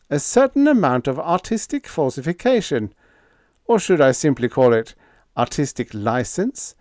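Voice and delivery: put-on polite young man selling calmly; lightly voiced Biggs. A narrator's reading. Young officer addressing the governor, bland and smooth.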